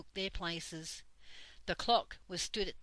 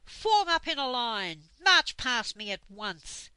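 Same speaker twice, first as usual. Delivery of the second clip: loud barked orders; fierce shout; 5 semitones higher, 10 dB louder than usual. A narrator's reading. Dame Slap ordering everyone into line, fierce shout. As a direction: command them like a furious sergeant. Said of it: "in a very fierce voice".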